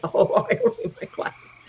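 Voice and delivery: laughed through, high and breathless; laughing. Retold or imitated. Laughing at her own prideful thought about glasses. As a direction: giggle through the proud thought.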